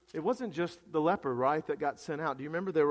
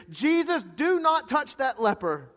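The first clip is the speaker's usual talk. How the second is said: high, loud, alarmed plea; emphatic cry, slowing on the command; urgent warning; 8 semitones higher, 8 dB louder than usual. Acted. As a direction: warn him off in alarm. Voicing an alarmed warning to Jesus.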